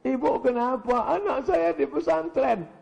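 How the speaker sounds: high tearful mother voice; mock crying. Preacher plays mother missing her child, mock-tearful. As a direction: wail it like a weeping mother. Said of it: "matanya bengkak nangis".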